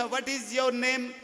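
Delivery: slow, drawn-out mock English accent; an Indian comic mimicking a tourist asking his name, in slow mock English; mock foreign tourist. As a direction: slowly drawl each word like a foreign visitor. Imitating English tourist woman.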